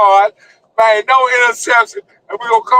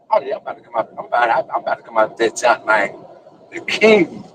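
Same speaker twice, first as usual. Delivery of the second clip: ordinary talk, nothing marked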